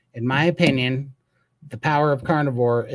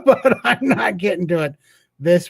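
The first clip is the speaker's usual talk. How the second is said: laughing through it; 7 semitones higher, 6 dB louder than usual. Retold or imitated. Retelling his snowed-in car, laughing at himself. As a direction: quote of himself that breaks into a laugh, pitch jumping up. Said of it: "I was like".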